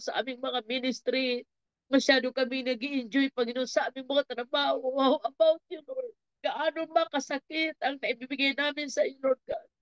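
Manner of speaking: pleading prayer, rising in pitch as it builds; fervent prayer. Real, not acted. Preacher prays for everyone in the congregation, fervent pleading. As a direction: plead with God, stretching the key phrases.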